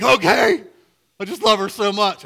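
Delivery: very high, loud blubbering imitation; hammed-up tearful outburst of love; mock crying groom. Groom watching his bride come down the aisle, mock crying. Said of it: "and he's crying".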